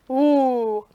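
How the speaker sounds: low ooh. Shown as a demonstration. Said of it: "or our low voice".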